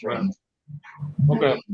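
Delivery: talking through a mouthful of food; mouth full. Real, not acted. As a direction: say it while chewing. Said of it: "Sorry, guys, my mouth is full".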